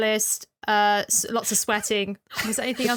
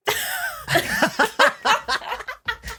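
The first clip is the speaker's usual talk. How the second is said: snorting laugh; 9 semitones higher than usual. Real, not acted. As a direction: burst out with a snort. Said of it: "done my snort laugh twice".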